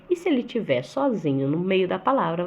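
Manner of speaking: ordinary delivery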